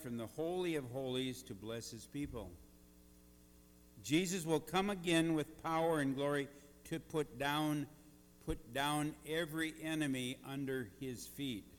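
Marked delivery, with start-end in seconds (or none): 4.0-11.6 loud, rising sermon climax; booming motivational speech. Acted. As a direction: preach it out to the back pews. Preaching at full force.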